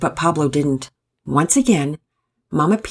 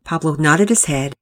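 usual speaking voice

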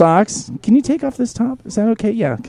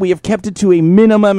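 normal talking voice

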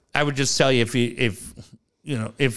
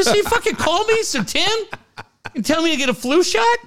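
exasperated outburst. Retold or imitated. Retold outburst at Kaiser.

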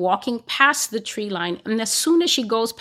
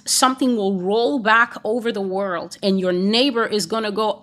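ordinary delivery

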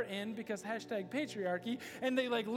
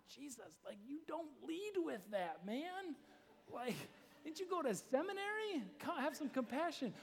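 incredulous objection; 3 semitones higher, 8 dB quieter than usual. Acted. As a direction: plead upward in disbelief, not shouting. Mock scolding of Jesus.

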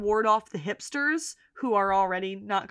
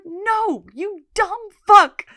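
loud, high mock put-down; hammed-up retold contempt; imitated scornful delivery; 10 semitones higher, 9 dB louder, slower than usual. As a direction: relish the insult, louder and slower at the end. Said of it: "I loved her delivery there".

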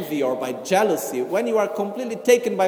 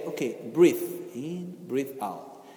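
slow, soft guiding voice, trailing lower and quieter; calm an angry person down gently; calming breathing cue; 4 semitones lower, 5 dB quieter, slower than usual. Shown as a demonstration. Teacher soothes an angry visitor: slow soft breathing cue. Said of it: "I look to the person talking to me and I say".

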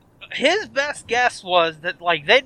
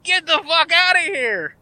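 mock disbelief; 7 semitones higher than usual. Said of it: "And he goes".

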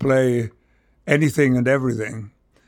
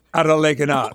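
broad Yorkshire, words run together; Yorkshire dialect; faster than usual. Shown as a demonstration. Showing how he asked friends out to play, Yorkshire dialect. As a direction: say it in local Northern England dialect. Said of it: "That was how I spoke".

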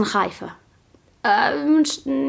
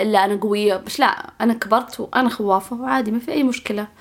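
ordinary talk

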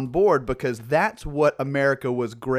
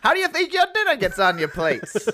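put-on old-timey voice; 7 semitones higher than usual. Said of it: "anytime that voice starts".

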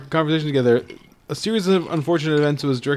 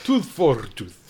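put-on a Slavic accent on Tooth for tooth; slower than usual. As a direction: imitate an actor's stiff Slavic accent. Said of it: "It's inter-Slavic accent".

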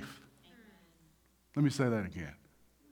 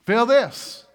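loud, raised insistent command; enemy's urging; 5 semitones higher, 7 dB louder than usual. Acted. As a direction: push it at them as the tempter. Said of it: "the enemy will bring you".

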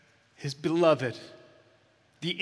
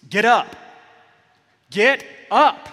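loud command; 7 semitones higher, 11 dB louder than usual. Pretend. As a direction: shout it much higher and louder. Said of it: "You wanna say it?".

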